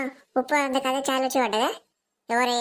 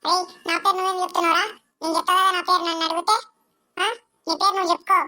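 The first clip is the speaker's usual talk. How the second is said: put-on indignant little boy; 6 semitones higher than usual. Younger boy scolds his brother.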